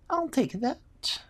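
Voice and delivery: high, slow squeaky kobold voice; drawn-out high-pitched kobold; kobold claiming a prize. Acted. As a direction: claim it in a tiny creature's voice. Narrator voices kobold grabbing spear.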